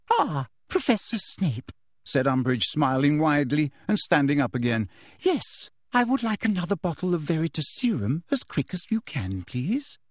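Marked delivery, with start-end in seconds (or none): 0.1-1.7 high, slow, sugary greeting; sweet Umbridge. A narrator's reading. Umbridge greeting Snape, high and sugary. 5.2-10.0 drip with false warmth; sweet Umbridge. A narrator's reading. Umbridge greeting Snape, high and sugary.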